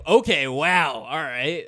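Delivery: mildly acted startled response; taken-aback reaction. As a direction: imitate absorbing a blunt remark. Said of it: "I just, I reacted".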